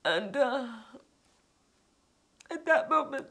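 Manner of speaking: high, tearful voice with long halting pauses; really fighting back tears while telling it; choked up. Real, not acted. Choked up recalling the moment.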